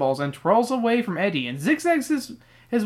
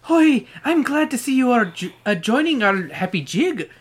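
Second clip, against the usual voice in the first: higher-pitched playful Irish voice; put-on Irish accent; 5 semitones higher than usual. Mister O'Grady greeting Eddie at the jig, Irish accent. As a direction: greet them in a lilting stage Irish accent. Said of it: "I like your Irish accent there".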